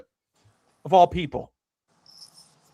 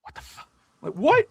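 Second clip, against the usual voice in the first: sudden high shocked what; stunned and incredulous; shocked disbelief; 9 semitones higher than usual. Retold or imitated. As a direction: react like you can't believe the score. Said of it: "And I'm like".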